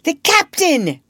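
high, loud startled exclamation; surprised. A narrator's reading. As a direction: cry out in astonishment. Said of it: "staring at each other in surprise".

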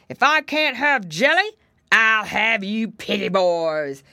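loud bear threat, slowing at the end; growly bear. A narrator's reading. Bear claiming the piggy boys instead, growling. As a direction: growl a loud threat, dragging the last words.

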